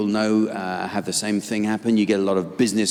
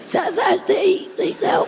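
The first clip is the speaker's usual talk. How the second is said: mocking electrician voice. Mock voice of the electrician.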